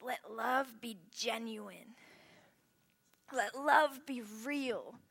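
slow, deliberate verse read; slow reverent reading; scripture reading. Shown as a demonstration. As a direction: read the verse slowly, letting it land.

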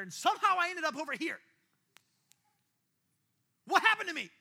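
loud, high-pitched bewildered cry; retold anguished outcry. Tearful student confessing the fall, loud anguished retold outcry. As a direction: cry out in disbelief at yourself. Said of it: "as they were bawling".

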